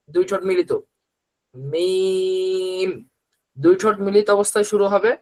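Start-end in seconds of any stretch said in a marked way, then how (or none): 1.5-2.9 মিম with lips closed at start and end, held long; long drawn-out meem. Shown as a demonstration. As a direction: stretch মিম slowly, lips shut at both ends. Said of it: "ধরেন". (ঠোঁট বন্ধ রেখে টেনে মিম)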